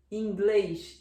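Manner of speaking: ordinary talk, nothing marked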